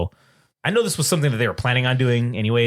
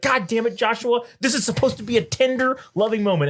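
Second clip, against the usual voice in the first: high-pitched imitation of an annoyed partner; retold exasperation, pitched up; mock exasperated scolding; 9 semitones higher than usual. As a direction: scold in a put-on higher voice.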